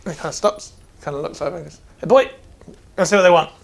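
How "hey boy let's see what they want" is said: loud barking dwarf smith; gruff dwarf voice. Acted. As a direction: call out like a burly dwarf. Dwarf smith calls to apprentice.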